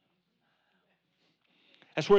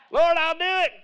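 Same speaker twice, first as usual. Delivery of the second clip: loud, high, boastful call; talking big; 8 semitones higher, 5 dB louder than usual. Acted. Peter boasts to the Lord from the boat, talking big. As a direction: belt it out with cocky eagerness. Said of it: "you, you're talking big".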